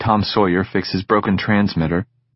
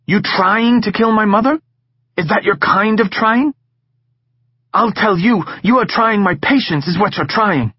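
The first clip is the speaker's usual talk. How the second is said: higher, louder, sarcastic tirade; furious scolding; 12 semitones higher, 3 dB louder than usual. Acted. Missus Chang yelling at her husband about trying, furious. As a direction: tear into him, mocking.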